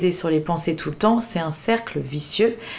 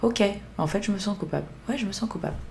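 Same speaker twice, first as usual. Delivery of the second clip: a quiet, accepting confession; soft admission of guilt; 3 semitones higher, 6 dB quieter, much faster than usual. Shown as a demonstration. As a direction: murmur it as you let it go. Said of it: "on le verbalise pour lâcher prise".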